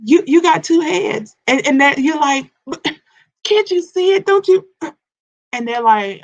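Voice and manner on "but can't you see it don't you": much higher, louder pleading question; exasperated pleading. Retold or imitated. Mimicking herself pleading with people who don't get it, high. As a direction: plead like nobody sees it.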